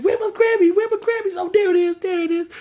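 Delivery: hammed-up high panic; freaking out. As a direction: panic then find it, still shrieking. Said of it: "Steve was freaking out".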